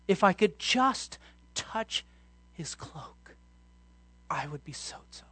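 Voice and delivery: slow, breathy inner thought in a whisper; the woman's secret hope, whispered; hushed whisper. Acted. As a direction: whisper it to herself, longingly. Woman's inner words said again.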